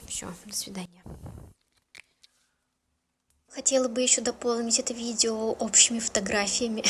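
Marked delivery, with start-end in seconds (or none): 0.1-1.0 whispering. Real, not acted. Signing off the video.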